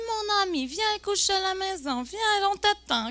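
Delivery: high-pitched, coaxing male host; mock welcoming host. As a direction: invite them over in a high put-on voice. Imite l'hôte marocain qui invite. (hôte accueillant imité, voix haute)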